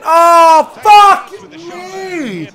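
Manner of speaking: high, loud disbelieving yell, last words drawn slow; stunned shout. Real, not acted. Gamer reacting to a crazy goal chance, stunned loud shout. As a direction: blurt it out in total shock.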